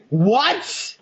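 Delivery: high, rising "what?"; retold incredulous what, voice sliding up; baffled mock what. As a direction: play up utter bafflement. Nick baffled again, acted.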